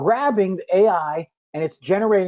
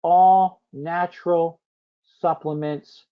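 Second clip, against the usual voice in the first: slow, word-by-word dictation; much slower than usual. Shown as a demonstration. Host dictating a prompt to the audience, slow and deliberate. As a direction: spell it out slowly while typing. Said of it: "I'm gonna get real specific here".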